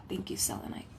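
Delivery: hushed whisper of thanks; soft whispered gratitude to the stone. Real, not acted. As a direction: breathe the thanks, no voice.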